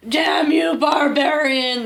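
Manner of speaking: shrieking soldier. Acted. Soldier cursing the barbarian who attacked his comrade, mock shriek. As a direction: shout it like an outraged soldier in pulp fantasy. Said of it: "Shrieked the soldier".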